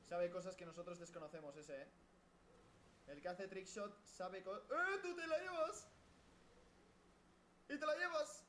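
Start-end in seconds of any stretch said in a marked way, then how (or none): 4.6-8.4 high raging gamer wail. Real, not acted. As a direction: wail at the game as you die. Gamer raging at being killed.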